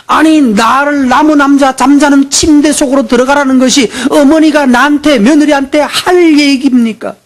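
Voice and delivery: impassioned sermon voice, slowing on the punchlines; fiery preaching. Acted. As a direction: preach with rising indignation. Preaching at full force.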